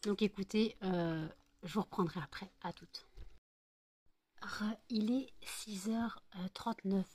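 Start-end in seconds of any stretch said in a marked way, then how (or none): 4.4-7.1 slow hushed whisper. Real, not acted. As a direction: whisper the time softly. Late-night return, hushed.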